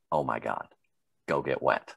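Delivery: low, soft, weary imitation; disappointed instructor. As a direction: say it like a fed-up instructor, dropped low and quiet. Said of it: "pretty soon you hear this".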